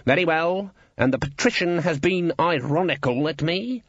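high, pompous character voice; affronted guild boss. A narrator's reading. Guild boss resenting the patrician, affronted. Cleet's indignant decision.

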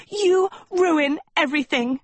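high, outraged attack; furious outburst. A narrator's reading. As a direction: hurl the accusation in a high voice. Goblin Sophie tackles her friend.

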